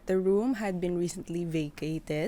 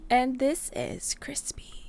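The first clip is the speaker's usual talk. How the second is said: whisper; 6 semitones higher, slower than usual. Acted. Podcast intro whisper.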